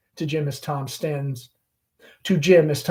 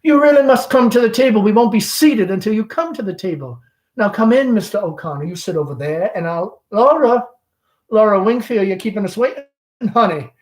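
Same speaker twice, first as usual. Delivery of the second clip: louder, quicker coaxing command; insistent call; 6 semitones higher, 7 dB louder than usual. Acted. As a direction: summon her firmly but sweetly. Amanda insisting Laura come.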